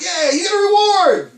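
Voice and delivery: playful high shout of joy; celebratory cheer. Acted. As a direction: cheer it like handing out a prize. Mock cheer for promised reward.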